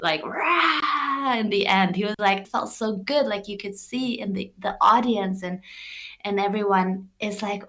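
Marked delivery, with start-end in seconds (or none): 0.2-1.3 long, higher-pitched "raaah" roar; full lion roar. Retold or imitated. Man roaring after being coached on stage, full lion roar. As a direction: imitate the roar let out properly, drawn out. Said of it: "you can do better than that".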